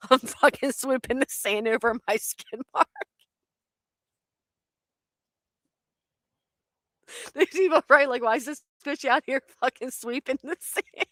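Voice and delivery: high, laughing through the words; helpless laughter. Real, not acted. Storyteller picturing neighbours watching her sweep sand, helpless laughter. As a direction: barely get it out for laughing. Cracks up imagining onlookers.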